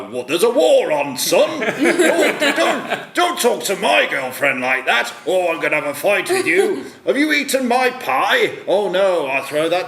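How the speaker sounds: loud, high hammed-up Australian accent, laughing through it; lampooning the film's bad Australian accents; over-the-top Aussie bloke, shouting and laughing; mock Australian accent. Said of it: "They haven't got this accent there".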